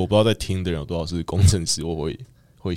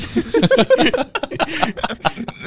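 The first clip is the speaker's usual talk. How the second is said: laughing. Real, not acted. Laughs at the picture.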